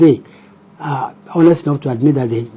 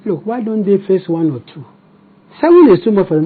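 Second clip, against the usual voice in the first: ordinary delivery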